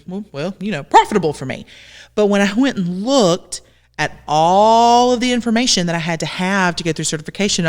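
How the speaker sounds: ordinary talk, nothing marked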